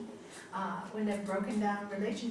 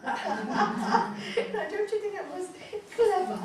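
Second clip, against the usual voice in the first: laughing; 9 semitones higher, 6 dB louder, slower than usual. Real, not acted. Delighted by the wordplay.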